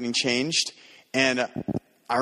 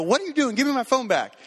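mock alarm; 11 semitones higher than usual. Kid grabs his phone.

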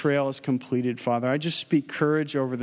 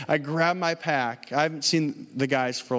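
normal talking voice

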